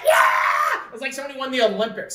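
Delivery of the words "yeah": high, whooping shout of triumph; celebrating cheer. Retold or imitated. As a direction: cheer like a whole crowd winning. Everyone cheering in the retold moment.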